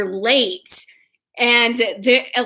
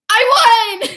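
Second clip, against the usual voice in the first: high, loud retold victory cry; triumphant shout; 11 semitones higher, 6 dB louder than usual. Player gloats after winning cosplay chess, triumphant shout. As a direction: crow it like a gloating winner.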